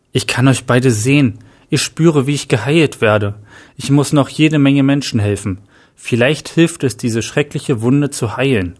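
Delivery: ordinary delivery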